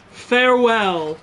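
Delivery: slow, hammed-up mock farewell; quoting the farewell meme. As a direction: stretch it out like the meme. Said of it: "I love that video".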